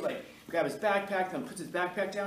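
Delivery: usual speaking voice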